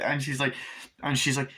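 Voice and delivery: ordinary talk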